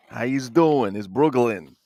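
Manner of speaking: thick New York greeting, hard g in Brooklyn; heavy Brooklyn accent. Acted. Collector imitating a Brooklyn local's greeting, heavy accent. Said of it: "you got that heavy accent".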